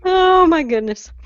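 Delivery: high, slow swoon over the voice; drawn-out flustered swoon. Real, not acted. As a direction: sigh it out, flustered. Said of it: "I'm gonna fan myself now".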